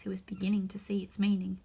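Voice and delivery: ordinary delivery, nothing marked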